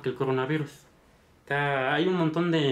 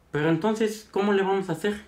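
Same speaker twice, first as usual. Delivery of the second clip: exasperated plea; 7 semitones higher than usual. Retold or imitated. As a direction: press the doctor, pitched up in frustration. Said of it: "Entonces el señor dice". (súplica exasperada imitada)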